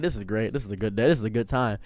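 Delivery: a soft, low content murmur; quiet aside. Retold or imitated. With the femboy on his lap, a soft, low murmur. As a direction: savour it under your breath. Savouring the moment privately.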